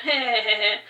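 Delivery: short breathy chuckle of mischief; amused giggle at one's own trick; mischievous laugh. Acted. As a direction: give a little scheming laugh. Mischief as granddaughter disguise is told.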